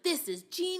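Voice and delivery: high, delighted exclamation; a teacher recalling her big idea; mock amazement. Retold delight at a teaching hack. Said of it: "I was like".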